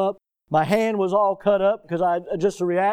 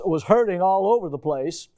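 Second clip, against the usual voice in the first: usual speaking voice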